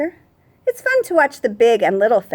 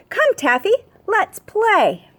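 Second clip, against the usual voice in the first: put-on much higher little girl calling the cat; child calling cat; 9 semitones higher, slower than usual. A narrator's reading. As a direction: call the kitty to play like a small girl.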